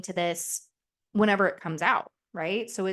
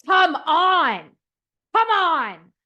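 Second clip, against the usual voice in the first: loud, high, tense urging from the stands; will him on like a player's coach courtside; intense cheering; 8 semitones higher, 7 dB louder, much slower than usual. Retold or imitated. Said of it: "Sitting there going".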